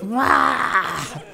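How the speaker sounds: high, drawn-out comic "aah"; mock scary yell. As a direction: imitate a wild-eyed preacher's cry. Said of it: "You know, you know that look".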